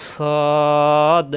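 "সদ" with a heavy, long-held vowel; articulation demo. Shown as a demonstration. Teacher shows where tongue meets teeth, drawn-out "সদ". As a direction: draw out "সদ" on one steady note. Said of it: "জিহ্বা নিচের দাঁতে চাপ দিয়ে".

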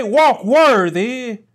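slow, weighty "Walk worthy" with "Walk" stressed and falling; emphasis on walk. Shown as a demonstration. Preacher stressing Paul's word "Walk" for the congregation. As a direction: lean hard on "Walk" and drag it out. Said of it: "I must put emphasis on the use of the word walk".

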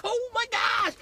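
very high falsetto squeal; giddy amazement. Retold or imitated. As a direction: squeal it in mock overjoyed disbelief. Retold reaction to hitting goal.

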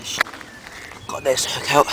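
usual speaking voice

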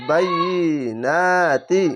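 বাইয়িনাতি very drawn out, long "yyi" and "naa"; doubled letter. Shown as a demonstration. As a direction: recite বাইয়িনাতি slowly, leaning on the doubled y. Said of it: "সেই অক্ষরটি দুবার উচ্চারণ হবে".

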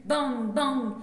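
two loud bangs, each falling; imitated gunshots. Poet voicing cowboys shooting from horseback, loud mock gunfire. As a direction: fire the bangs off like shots.

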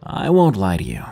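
plain reading voice

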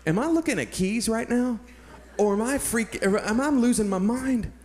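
high-pitched disbelief, retold; freaking out. Hiker spotting lost keys at his foot, freaking out. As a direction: question your own eyes in amazement. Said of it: "I was freaking out, guys".

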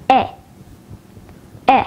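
short "E" as in bed; quick, isolated "E", the vowel of head; short vowel demo. Shown as a demonstration. Teacher showing learners the short vowels, "E" said short. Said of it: "Take a look at the short vowels".

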